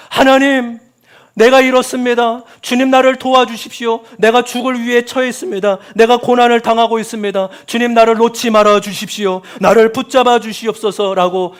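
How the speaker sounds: loud, high desperate plea to God; lamenting prayer. Acted. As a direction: cry out to God for rescue. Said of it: "탄식시예요".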